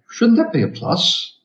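high, slow, doubtful query from the lecture hall; puzzled question. Retold or imitated. Students querying the lecturer about his maths, high and doubtful. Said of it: "we both said".

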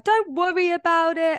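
sugary polite cover while secretly bothered; fake-sweet reassurance. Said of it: "you smile, and you're like".

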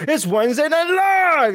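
big hyped TV announcer call; full-body show announcer. Shown as a demonstration. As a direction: throw your whole body into the announcement. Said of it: "throw your whole body into it".

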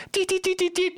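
rapid, very high electronic beeping; piercing little machine bleeps; imitated scouter beeps. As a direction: squeak out a gadget's readout beeps. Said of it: "just pulls a scouter".

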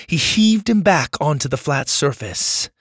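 usual speaking voice

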